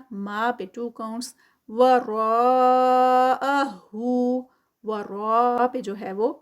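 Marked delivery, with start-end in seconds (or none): 1.7-4.4 ورا held long and slow, four counts; stretched madd. Shown as a demonstration. Teacher chanting the word slowly for students, stretched. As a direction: draw out the long vowel in وراءہو. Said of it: "متصل ہے، جڑی ہوئی ہے، four counts".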